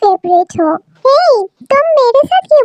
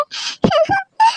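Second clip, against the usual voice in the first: high breathy laugh; 10 semitones higher, 5 dB quieter than usual. Acted. Laugh after sad-life talk.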